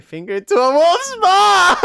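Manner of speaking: loud, high, laughing through it. Real, not acted. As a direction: laugh while saying it. Narrator breaks into laugh.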